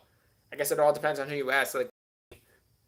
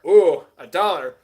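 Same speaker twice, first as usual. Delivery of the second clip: surprised; 3 semitones higher, 12 dB louder, slower than usual. Real, not acted. Reacting to a one-dollar bid.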